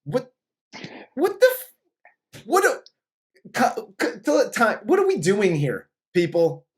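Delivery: sputtering, high-pitched disbelief; Jack Palance losing it. Retold or imitated. Actor baffled by his stage directions, sputtering disbelief. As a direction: stammer in rising bafflement, voice jumping high. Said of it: "losing his shit".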